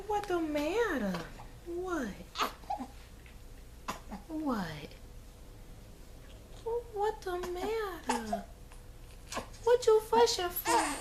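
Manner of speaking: very high, soft cooing. Real, not acted. Mom soothing fussing baby.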